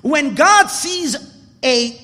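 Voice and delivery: loud, high, slow sermon climax; impassioned preaching. Real, not acted. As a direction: drive each phrase home to the congregation, stretching the key words. Preaches the climax at full force.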